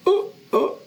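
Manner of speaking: high, whiny resigned captive; frightened whimpering captive. Acted. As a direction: whimper in high-pitched defeat.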